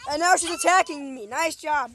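loud exasperated sarcasm; sarcastic loud complaint. Real, not acted. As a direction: throw the blame at Jordan. Dog attacks.

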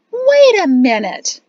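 high, drawn-out indignant cry; loud exclamation. A narrator's reading. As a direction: exclaim it loudly, pitched right up. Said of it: "Jatsi exclaimed loudly".

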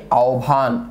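আহ্বান said as "ao-bhan"; standard pronunciation. Shown as a demonstration. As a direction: sound আহ্বান as "ao-bhan", low and measured. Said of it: "প্রমিত উচ্চারণ কোনটি".

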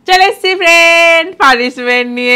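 loud, high gleeful call; gleeful announcement. Acted. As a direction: announce it with glee.

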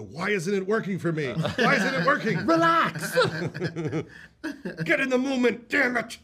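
high, loud exasperated yelling; frustrated outburst. Retold or imitated. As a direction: yell at yourself to relax. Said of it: "and just go".

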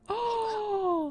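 high, soft gasp of surprise; delighted gasp. Real, not acted. Player discovers thrift and bubble tea store, surprised gasp.

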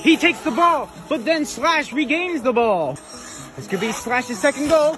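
high announcer voice, slowing for suspense; mock match commentary, very high on the surprise; play-by-play commentary. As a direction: stretch out the big save. Commentates toy soccer match.

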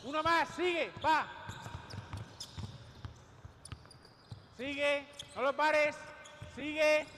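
loud commands; barking gym coach. Real, not acted. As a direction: bark each call. Coach urging players during drill.

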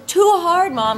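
put-on high-pitched little-kid complaint; imitated toddler. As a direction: mimic a small child struggling. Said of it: "And she goes".

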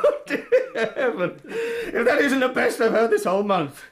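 laughing through the words; can barely speak for laughing. Acted. Student laughing off the inspector's accusation.

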